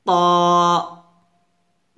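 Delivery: প said as a heavy "taw"; tongue firmly on upper teeth, প as rounded "taw"; full, dark emphatic T; thick forceful T. Shown as a demonstration. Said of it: "মোটা করে উচ্চারণ করতে হবে".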